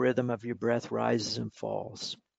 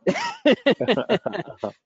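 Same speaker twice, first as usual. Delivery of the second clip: real laugh; 10 semitones higher, 6 dB louder than usual. Real, not acted. Laughs at host's dead joke.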